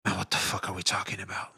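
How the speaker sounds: hushed, unvoiced mutter; soft exasperated whisper; whispered aside. Real, not acted. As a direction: drop to a breathy whisper to yourself. Muttered aside under breath.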